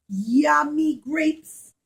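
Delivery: ordinary delivery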